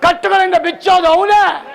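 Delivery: loud, high, ringing sermon cry; full-force revival preaching; fiery preaching. Real, not acted. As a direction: preach it out to the whole hall.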